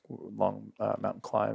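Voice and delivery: ordinary delivery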